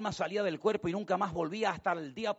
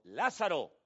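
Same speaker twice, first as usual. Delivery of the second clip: Lázaro called out, then sal fuera blasted much louder; a loud, ringing command to the dead; commanding shout; 3 semitones higher, 9 dB louder, slower than usual. Acted. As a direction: shout him out of the tomb. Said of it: "la Biblia dice que el Señor gritó".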